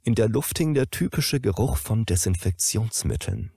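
usual speaking voice

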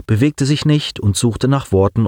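plain reading voice

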